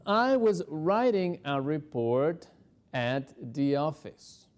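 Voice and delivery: slow and clear; slow model answer; slow answer drill. Shown as a demonstration. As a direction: drill it slowly. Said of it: "¿Qué estamos diciendo en esta respuesta?".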